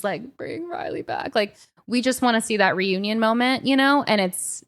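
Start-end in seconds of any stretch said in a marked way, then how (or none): 0.4-1.3 high, soft whispered plea; whispered imitation of a sobbing mother; mock tearful plea. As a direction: imitate a grieving mom begging through tears. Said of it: "the mom, like, bawling her eyes out".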